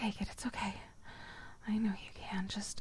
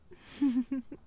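teasing laugh; 3 semitones higher than usual. Acted. Teasing laugh during roleplay.

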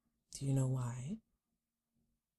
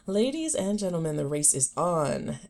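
announcer voice; 2 semitones higher, 8 dB louder than usual. Pretend. Mock race announcement.

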